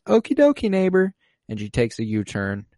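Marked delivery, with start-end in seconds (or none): 0.1-1.1 much higher, louder sing-song; chirpy sitcom neighbor. Retold or imitated. Agnes back under control, chirpy high neighbor voice. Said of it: "And she says".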